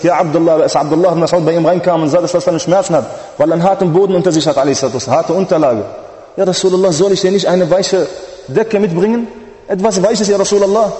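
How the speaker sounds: high, loud tearful plea, slowing on the kings; fervent pleading preaching. Acted. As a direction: plead with the Prophet at full force. Ibn Mas'ud offers soft blanket.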